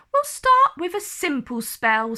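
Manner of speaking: a hint of a bright teacher starting class. A narrator's reading.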